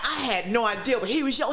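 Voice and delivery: normal talking voice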